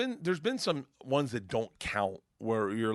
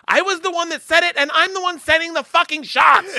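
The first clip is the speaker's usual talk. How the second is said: loud, high heckling woman; full-volume shrieking heckle; heckler's yell; 13 dB louder than usual. Retold or imitated. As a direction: yell it from the crowd, high and furious. Heckling woman yells in his story.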